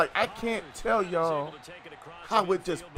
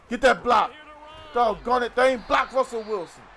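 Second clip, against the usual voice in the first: yelling at the game; 5 semitones higher, 4 dB louder than usual. Real, not acted.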